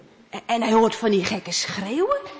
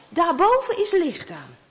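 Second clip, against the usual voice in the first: usual speaking voice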